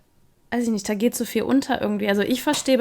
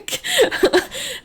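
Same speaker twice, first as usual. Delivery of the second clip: high, loud burst of laughter; startled laugh; 5 dB louder than usual. Real, not acted. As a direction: laugh out in disbelief. Laughs after goosebumps on one arm.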